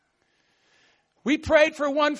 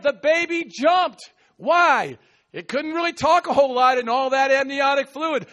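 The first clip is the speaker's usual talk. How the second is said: ordinary talk, nothing marked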